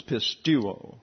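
"pistuo" said slowly as pis-TOO-oh; long U, accented. Shown as a demonstration. Teacher showing a class the Greek verb, "pistuo" with a long stressed U. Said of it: "gets a long U sound".

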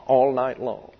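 ordinary delivery

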